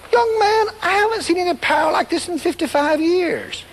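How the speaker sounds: lightly voiced old missionary, amazed. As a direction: marvel at it like an elder who's seen revivals. Old missionary speaks to him.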